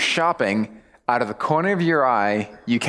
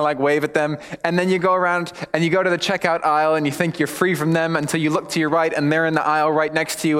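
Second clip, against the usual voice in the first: normal talking voice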